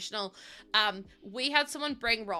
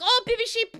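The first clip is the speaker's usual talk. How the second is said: high, loud cooing squeal; delighted baby-animal squeal; excited squeal; 13 semitones higher, 8 dB louder than usual. Retold or imitated. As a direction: gush over something adorable. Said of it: "Or I'm like".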